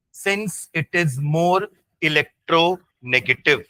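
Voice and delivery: very slow, phrases repeated for note-takers; teacher's drawn-out dictation voice. Real, not acted. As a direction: dictate word by word, leaving gaps. Dictating notes to students.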